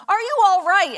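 high, loud mock concern; concerned bystander. Somebody comes to her at the accident, loud imitated concern. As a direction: call it out like someone rushing up to help.

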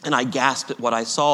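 usual speaking voice

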